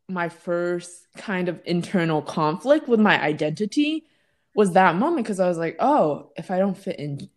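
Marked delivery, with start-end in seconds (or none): none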